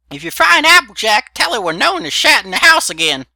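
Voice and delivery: put-on very high, loud old granny call. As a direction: shout it after him like a cranky grandma. Granny calls after him.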